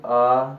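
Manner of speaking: आ held long and loud; long aa vowel. Shown as a demonstration. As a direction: stretch the vowel आ out clearly. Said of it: "अब इनको हम सजातीय स्वर में रखेंगे". (लंबा खींचा हुआ आ)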